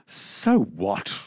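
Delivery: ordinary delivery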